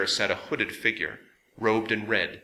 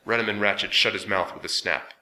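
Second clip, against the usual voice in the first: plain reading voice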